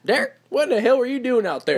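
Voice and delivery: high Southern mom scolding with country twang. Retold or imitated. Southern stepmom telling off Derek, country twang. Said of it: "little country twang to it".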